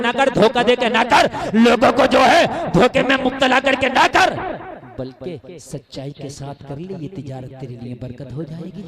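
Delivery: loud, driving sermon voice; fiery preaching. Acted. Preacher to jalsa crowd, loud fiery preaching. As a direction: preach it out to the crowd at full volume.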